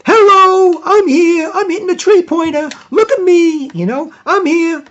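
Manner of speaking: very high, loud broadcaster impression; Jack Armstrong. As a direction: call it out excitedly, shouting the bonjours. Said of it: "and he'll say".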